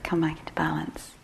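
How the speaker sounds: soft whispered self-soothing; hushed calming whisper. Retold or imitated. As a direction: gently call yourself back. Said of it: "It's like".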